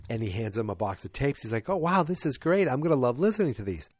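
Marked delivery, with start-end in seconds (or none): 1.7-3.9 higher, mock-grateful gushing; delighted thanks. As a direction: lightly act thrilled by a gift. Acting delighted thanks for gift.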